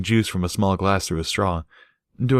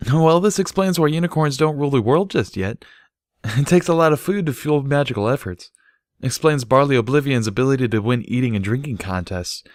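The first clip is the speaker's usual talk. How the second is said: higher mare voice, amused; Barry, amused; 7 semitones higher than usual. Acted. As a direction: toss out the theory with a grin. Barry muses on unicorns.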